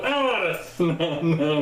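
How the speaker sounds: high, laughing oh no no; mock-shocked old lady caught out. Lady's shocked reaction.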